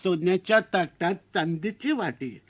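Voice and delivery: put-on slow, drawn-out old-fashioned rhyme recital; slow sing-song verse; mother-in-law reciting rhyme. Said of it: "आमच्या सासूबाईंनी उखाणा घेतला होता".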